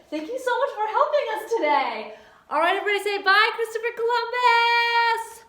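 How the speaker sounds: high, loud, fast teacher cheer; rally the whole class into a singsong goodbye; bubbly classroom farewell; excited send-off. Pretend. Teacher leads class goodbye to puppet.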